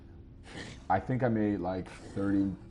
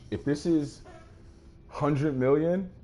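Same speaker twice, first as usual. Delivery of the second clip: ordinary delivery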